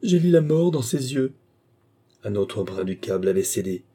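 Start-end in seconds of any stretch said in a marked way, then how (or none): 0.0-1.3 high, louder cry of terror; despairing cry. Acted. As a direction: she has seen death coming and cries out. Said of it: "cria Marie".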